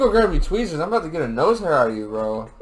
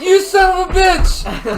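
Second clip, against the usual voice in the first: high, loud, drawn-out cursing; real indignant yell at the culprit; outraged shout; 13 semitones higher, 7 dB louder, slower than usual. Real, not acted. Man whose favourite hair was plucked, outraged shout.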